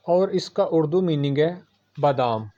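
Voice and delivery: ordinary talk, nothing marked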